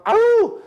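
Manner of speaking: high-pitched "ow!" outburst; sudden yelp. Real, not acted. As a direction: let out a short, high yelp mid-explanation. Exclamación súbita en plena explicación.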